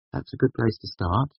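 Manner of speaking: put-on a playful nudge at his childish wish; teasing. A narrator's reading. To Kvothe wanting water, a woman teases lightly. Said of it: "she teased".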